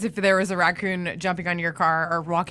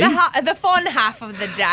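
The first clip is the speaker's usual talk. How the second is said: louder, hammed-up British accent; playful British vowels, pitched up; 4 semitones higher, 5 dB louder than usual. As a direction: echo it in a mock-British accent, laughing. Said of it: "singing in British accents off the air".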